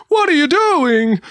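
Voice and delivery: high, loud alarmed cry; panicked baker. Pretend. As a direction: yelp in horror. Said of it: "He goes".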